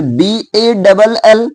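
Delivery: slow spelling. Shown as a demonstration. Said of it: "ए को आ बोला जाता है".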